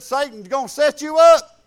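loud, firm warning to a friend; emphatic loud warning; stern warning. Retold or imitated. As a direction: warn him hard. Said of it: "And I told him, I said".

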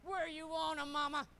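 loud, high, chest-out show-off; puffed-up husband. Acted. Husband hauling ten bags asks his wife, proudly showing off. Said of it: "sucks his belly in and pokes his chest out".

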